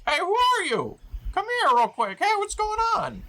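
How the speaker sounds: very high, squeaky goblin hailing; confused goblin. Acted. Goblins back to back calling to the stranger, high confused voice. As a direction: call out high and demanding as a goblin. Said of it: "They're like".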